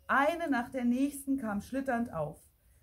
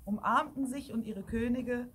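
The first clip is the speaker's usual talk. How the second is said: plain reading voice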